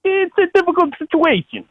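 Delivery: very high-pitched actor impression; James Gandolfini. Shown as a demonstration. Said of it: "say with the Gandolfini accent".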